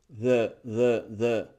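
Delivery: "the" as a low, short thuh; weak schwa. Shown as a demonstration. Teacher drills learners on the article, weak thuh. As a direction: drop "the" on a neutral uh. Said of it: "Es débil".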